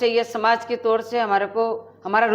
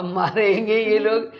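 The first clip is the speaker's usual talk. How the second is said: imitating fear; 6 dB louder, slower than usual. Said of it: "पहले बात तो मैं डर गई".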